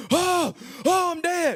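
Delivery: high, drawn-out mock wail; screaming. High man thinks he is dead, mock screaming. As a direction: wail as if you believe you died. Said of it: "So I started screaming".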